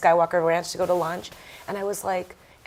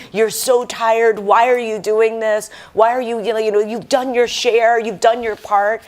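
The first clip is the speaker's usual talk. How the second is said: inner scolding; 4 semitones higher, 8 dB louder than usual. Retold or imitated. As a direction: tell yourself off like a fed-up parent. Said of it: "in my head".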